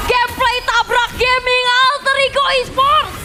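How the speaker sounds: fighting game announcer. Real, not acted. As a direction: announce the wipe out big. Announcing team wipe out.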